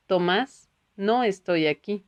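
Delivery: plain reading voice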